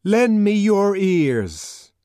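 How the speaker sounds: high, loud, slow oration; declamatory public plea; earnest cry. A narrator's reading. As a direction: appeal to a crowd with drawn-out words. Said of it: "cried the savage earnestly".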